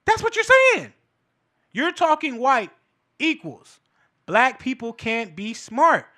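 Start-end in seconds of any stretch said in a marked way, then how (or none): 0.0-0.9 very high, loud outburst; incredulous high-pitched exclamation; exclaimed point. Real, not acted. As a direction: fire it back in a pitched-up yell.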